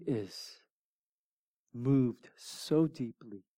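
slow, low and hushed; grave compassion with long pauses; solemn, tender. Real, not acted. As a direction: let each phrase land slowly, softly moved. Preacher dwells on Jesus weeping with Mary.

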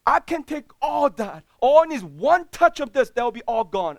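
rally the church with conviction; preaching at full force. Acted. Pastor proclaims God's call to the congregation, preaching loudly.

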